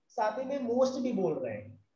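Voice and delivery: ordinary talk, nothing marked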